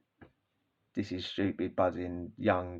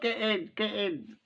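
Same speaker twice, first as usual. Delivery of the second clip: dad urging; 10 semitones higher, 5 dB quieter, slower than usual. Retold or imitated. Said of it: "He's got".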